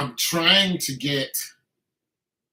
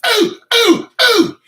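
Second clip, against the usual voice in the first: high, loud excited whoop; excited ooh; 14 dB louder, slower than usual. Real, not acted. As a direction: hype up the big number. Exclaiming over his max lift.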